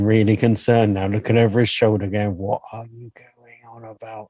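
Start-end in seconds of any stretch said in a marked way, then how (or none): 2.4-4.3 muttered goalie. Retold or imitated.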